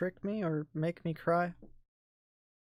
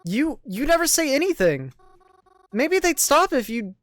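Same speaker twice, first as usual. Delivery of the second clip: raised, accusing plea; hurt anger; 9 semitones higher, 10 dB louder than usual. Acted. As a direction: push back at him, wounded. Mary arguing back.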